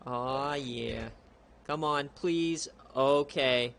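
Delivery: ordinary talk, nothing marked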